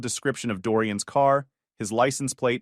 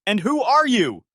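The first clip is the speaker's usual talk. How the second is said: high, loud challenge; sharp demand; 10 semitones higher, 6 dB louder than usual. Acted. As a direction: snap at the newcomer. Joyce whirls on Haley.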